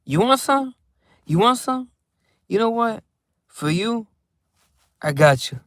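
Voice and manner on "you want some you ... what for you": much higher, teasing offer of food; high sing-song you want some; playful offer. Pretend. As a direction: tease him with a bite.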